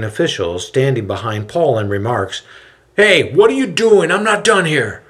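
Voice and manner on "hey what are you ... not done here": high, loud indignant protest; angry protest. A narrator's reading. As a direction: object hotly at being cut off. Angry merchant interrupted.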